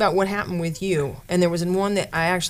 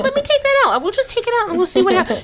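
high playful taunting voice; teasing mom; 5 dB louder, faster than usual. Retold or imitated. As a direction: tease them with a sing-song.

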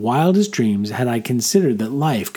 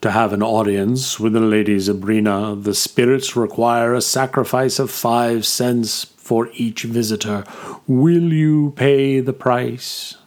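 solemn giant. A narrator's reading. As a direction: weigh each word like an oracle's guard. Giant demands the entrance fee.

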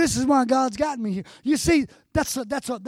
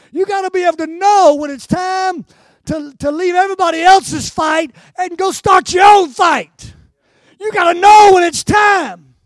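loud, raised shouting preacher; full-force preaching; 4 semitones higher, 12 dB louder than usual. Real, not acted. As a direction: drive it home to the congregation.

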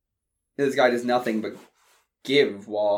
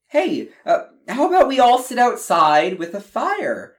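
higher, louder cheerful suggestion; mom's suggestion; 10 semitones higher, 6 dB louder than usual. Acted. Mom inviting the family outside, higher and lively. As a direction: offer it brightly like a hostess mom.

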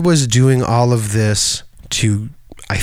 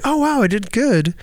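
higher, self-satisfied; high pleased; 12 semitones higher than usual. Retold or imitated. Imitating a performer pleased with praise, high. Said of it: "being like".

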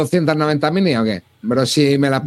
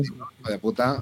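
cursing under breath; 4 semitones lower, 10 dB quieter, slower than usual. Real, not acted. Muttered curse at the price.

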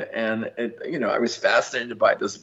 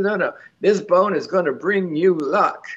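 put-on higher, insistent Southern old woman; imitated Southern accent; 7 semitones higher than usual. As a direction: promise it in a light Southern voice. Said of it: "in a very Southern accent".

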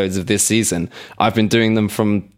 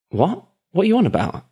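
baffled; 9 semitones higher than usual. Retold or imitated. Acting his baffled reply to friend.

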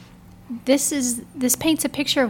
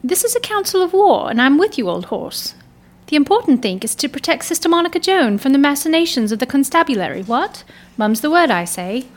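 plummy upper-class English, higher and louder, brisk and hearty; posh English accent; 3 semitones higher, 5 dB louder than usual. Acted. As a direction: read it like a jolly-hockey-sticks English gentlewoman. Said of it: "what they would call now a posh accent".

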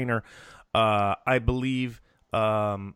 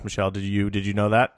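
ordinary talk

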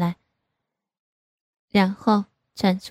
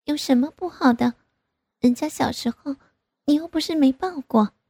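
higher-pitched pouty objection; protesting daughter; 6 semitones higher than usual. A narrator's reading. Grown daughter disputing her father's objection, protesting. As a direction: argue back in a higher voice.

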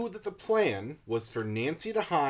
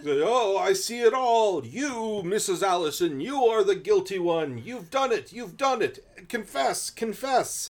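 high, loud, hammy fortune teller; fake psychic trance; 7 semitones higher, 5 dB louder than usual. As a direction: ham up a bad psychic accusing. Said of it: "the worst psychic impression".

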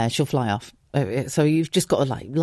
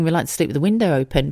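usual speaking voice

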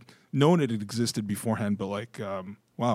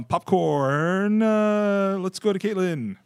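drawn-out call-out; 9 semitones higher, 4 dB louder, much slower than usual. Real, not acted. Host calls out the next reader.

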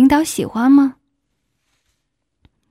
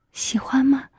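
whisper in his ear; 6 dB quieter than usual. A narrator's reading. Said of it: "在他耳边继续放肆".

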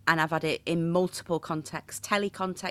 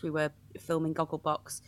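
ordinary talk, nothing marked

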